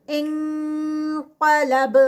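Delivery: انقلب drawn out, ending with a bounced qalqala on ب; slow recitation with stop. Shown as a demonstration. Teacher shows stopping with qalqala. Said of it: "جب اس کو روکیں گے".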